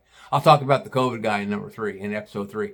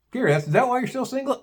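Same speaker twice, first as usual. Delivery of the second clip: teasing listener voice; 9 semitones higher, faster than usual. Retold or imitated. Imagined listener question.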